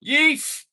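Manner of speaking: high yelp of glee; high excited yelp; excited squeal. Real, not acted. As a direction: let out a sudden happy squeal.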